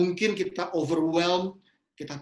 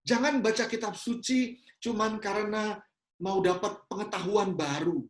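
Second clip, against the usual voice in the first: ordinary talk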